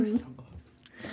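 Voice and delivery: slow, hushed whisper; drawn-out mock-intimate whisper; whispered aside. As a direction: whisper it slowly under the scene. Murmured aside in acted scene.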